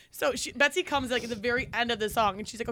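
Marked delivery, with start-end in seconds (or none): none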